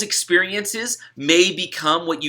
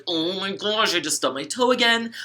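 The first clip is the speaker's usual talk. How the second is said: pitched up, pained cry over a stubbed toe; frustrated complaint; 5 semitones higher than usual. Pretend. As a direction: complain about the toe like it ruined the morning. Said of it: "okay, you could be super angry".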